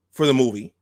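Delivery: ordinary delivery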